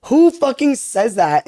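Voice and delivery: retold outrage, high-pitched and drawn out; furious disbelief. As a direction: stretch the words slowly in exasperated indignation. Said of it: "so furious".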